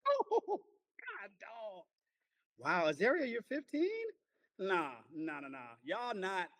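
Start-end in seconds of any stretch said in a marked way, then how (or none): 0.1-1.8 shocked cry. Real, not acted. Shocked by student's answer. 3.3-4.1 much higher incredulous "fifteen?"; high, loud disbelieving question. Real, not acted. Disbelief at student's age.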